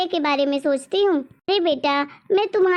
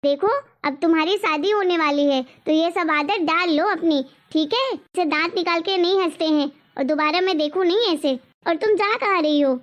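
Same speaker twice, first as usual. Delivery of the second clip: ordinary delivery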